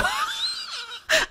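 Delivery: shrieking squeal of laughter; high squealing laugh. Real, not acted. Caught the moment.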